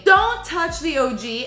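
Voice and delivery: ordinary talk, nothing marked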